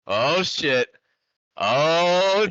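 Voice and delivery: loud, high startled oh shit; surprised shout. Real, not acted. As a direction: caught off guard and yelling. Champions arrive suddenly.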